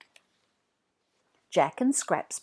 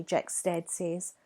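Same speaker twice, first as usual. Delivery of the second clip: usual speaking voice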